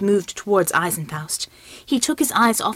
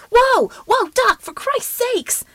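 high, loud alarmed shout of whoa; startled cry; 5 dB louder, slower than usual. Acted. Yankee Pride shouting at the doctor to stop, startled and high. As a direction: yelp it out as the doctor lunges.

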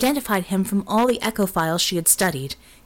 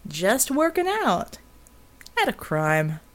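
mocking; slower than usual. A narrator's reading. Genie mocking Bull.

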